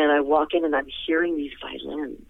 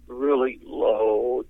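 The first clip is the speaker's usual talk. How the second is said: a slow, low demo of a deep voice; showing a deep voice; 4 semitones lower, much slower than usual. Shown as a demonstration. Singer describing the producer's voice, slow and low. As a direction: drag the words out low and slow. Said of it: "he had a really deep voice".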